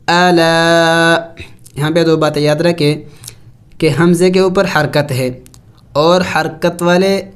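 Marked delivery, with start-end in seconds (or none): 0.1-1.2 الا very slow, alif held long; الا with the alif drawn out; lengthened alif. Shown as a demonstration. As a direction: stretch the vowel of الا. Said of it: "ایک الف کے مقدار لمبا کرنا".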